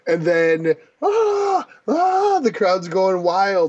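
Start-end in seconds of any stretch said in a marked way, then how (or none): 1.0-2.4 crowd roar. Acted. Crowd noise imitated.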